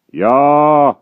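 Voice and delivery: loud defiant yell; cry. A narrator's reading. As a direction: jeer it at the giant. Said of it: "cried the imprisoned inventor".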